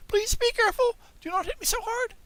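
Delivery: tiny, very high pleading voice of the living wood; a squeaky little plea not to be struck; wee beseeching voice. A narrator's reading. A tiny wooden voice begging the carpenter not to hit so hard. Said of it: "a wee little voice say in a beseeching tone".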